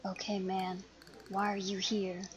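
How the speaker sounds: soft conspiratorial question; conspiratorial whisper. Acted. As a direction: lean in and ask quietly. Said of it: "whisper conspiratorially".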